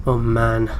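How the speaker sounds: ordinary talk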